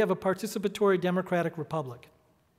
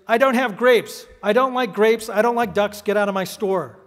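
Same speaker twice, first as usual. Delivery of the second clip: angry manager; 3 semitones higher, 7 dB louder than usual. Acted. Manager throwing the duck out of his store, angry. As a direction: start loud and emphatic, acting out an irate storekeeper.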